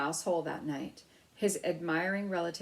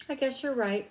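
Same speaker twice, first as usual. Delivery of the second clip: nervous; 7 semitones higher, 4 dB louder than usual. Acted. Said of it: "agreed Ralph's mother nervously".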